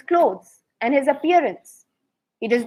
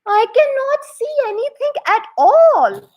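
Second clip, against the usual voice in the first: put-on old minister's thought, pitched much higher in alarm; dismayed old minister; 10 semitones higher than usual. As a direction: gasp it out, high and panicked, like a startled old man. Minister sees nothing on loom.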